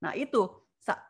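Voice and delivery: usual speaking voice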